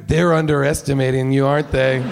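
low movie hero line. Retold or imitated. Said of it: "and he goes".

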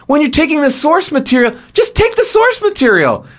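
high-pitched exasperated plea; exasperated protest. Acted. Host reacts to the film changing its source material, exasperated. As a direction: protest it high, in mock disbelief.

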